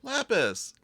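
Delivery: loud, high-pitched searching call; calling from afar. Acted. Pollux calling for Lapis off in the distance, calling out. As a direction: shout the name to someone out of sight. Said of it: "off in the distance".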